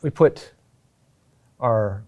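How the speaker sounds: normal talking voice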